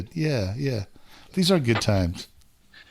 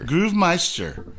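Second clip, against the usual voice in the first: slow announcer drawl; 4 semitones higher, slower than usual. Acted. Echoing nickname slowly.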